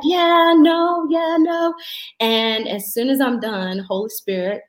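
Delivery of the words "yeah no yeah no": put-on 1 kids' singsong reply. Kids answering mom, mimicked.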